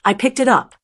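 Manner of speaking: usual speaking voice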